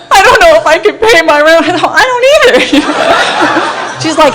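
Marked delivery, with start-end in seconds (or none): 0.1-1.6 mock crying. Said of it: "she was crying". 1.9-4.0 laughing. Real, not acted. Laughing at shared poverty.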